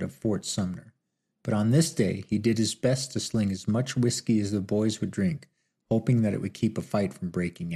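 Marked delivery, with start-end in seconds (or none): none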